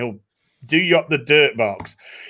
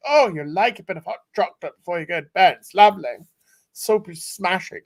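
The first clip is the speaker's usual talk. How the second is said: higher, fast, fawning maid; mock maid voice; 4 semitones higher, faster than usual. Fawning maid serving him hot chocolate before bed, lightly voiced. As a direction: gush like an eager housemaid.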